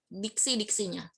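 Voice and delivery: normal talking voice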